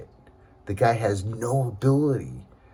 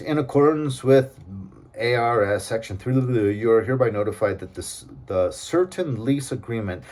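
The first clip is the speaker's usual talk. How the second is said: reads the notice aloud mockingly, stiff and official; mock-officious letter read; reading legal notice; 3 semitones higher, 4 dB louder than usual. Real, not acted. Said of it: "look how weird this is".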